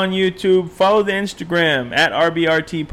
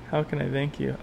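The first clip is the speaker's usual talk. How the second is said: normal talking voice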